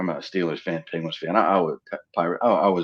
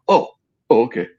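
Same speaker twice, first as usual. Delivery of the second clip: sheepish surprise; 3 semitones higher, 7 dB louder than usual. Retold or imitated. Corrected by the DJ.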